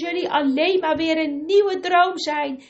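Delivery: high, loud terrified begging; desperate pleading stranger. Acted. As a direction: plead for your life from the water. Stranger begs from the water.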